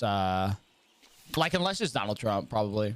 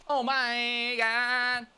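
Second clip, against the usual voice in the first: very slow, higher, drawn-out Walken; lightly done Walken; Christopher Walken impression; 9 semitones higher, much slower than usual. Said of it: "turn into Christopher Walken".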